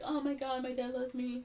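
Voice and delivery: high, softened gushing; touched daughter. Retold or imitated. Daughter learns dad got the corsage, high touched gushing. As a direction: squeal it softly, imitating delight.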